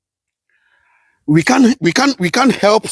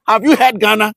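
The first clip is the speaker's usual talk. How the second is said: usual speaking voice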